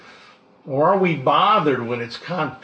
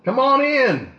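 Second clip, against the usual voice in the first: bright, much higher voice calling a cheerful welcome; a lighthearted Elisha inviting guests in; welcoming Elisha; 8 semitones higher, slower than usual. Retold or imitated. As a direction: throw the door open, higher and friendly. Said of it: "Elisha would run out and say".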